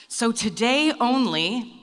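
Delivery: slow, loud salesman hook; pitchman voice. Pretend. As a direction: announce it like a trade show deal. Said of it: "I got my little Pitchman voice on".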